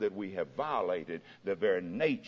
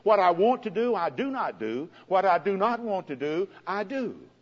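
higher, louder pleading lament; anguished cry; 10 semitones higher, 7 dB louder than usual. Retold or imitated. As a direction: cry out helplessly. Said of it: "he cried".

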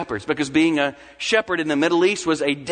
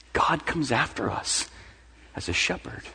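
ordinary talk

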